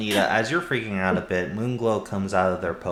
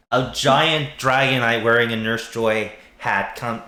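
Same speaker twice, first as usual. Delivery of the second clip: ordinary talk, nothing marked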